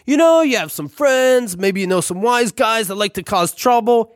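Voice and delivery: sly Italian landlord hinting at mischief; thick Italian accent. Retold or imitated. Landlord slyly suggests vandalism to tenant, thick Italian accent. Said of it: "in his thick Italian accent".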